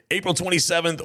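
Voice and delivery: usual speaking voice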